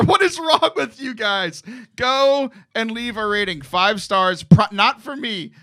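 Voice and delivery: usual speaking voice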